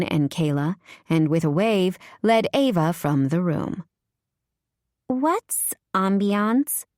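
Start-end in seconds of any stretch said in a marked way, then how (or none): 5.1-6.8 put-on slow, breathy little-girl voice; lightly voiced baby doll woman. A narrator's reading. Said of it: "the woman's baby doll voice ask".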